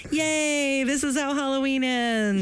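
high mock cheer; sarcastic cheer. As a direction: fake cheerful, dead inside. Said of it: "we were like".

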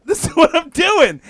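high, loud defiant protest; loud insistence. Retold or imitated. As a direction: insist loudly you're handling it. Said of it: "And I'm like".

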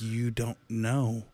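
slow, awed hush; in wonder. Retold or imitated. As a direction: say it slowly, amazed at what you see. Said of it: "Like, in wonder".

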